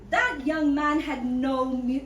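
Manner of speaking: ordinary delivery, nothing marked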